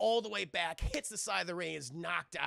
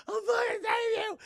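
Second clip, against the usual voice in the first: very high, loud, hammed-up scream; mock desperate shout; 7 dB louder than usual. Sonya as Jax is taken away, mock desperate shout. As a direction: scream a promise after someone dragged away, overdone.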